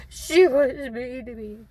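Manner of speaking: put-on high, loud, slow childish whine; mock whiny woman. Mocking a grown woman complaining, high childish whine. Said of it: "grown-ass fifty-year-old woman".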